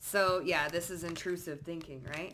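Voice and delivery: ordinary talk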